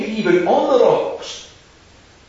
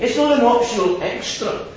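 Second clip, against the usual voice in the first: ordinary talk, nothing marked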